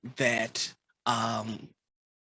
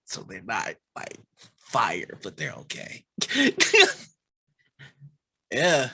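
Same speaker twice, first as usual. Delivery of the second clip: normal talking voice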